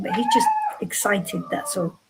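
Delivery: ordinary talk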